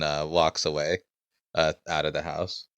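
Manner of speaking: usual speaking voice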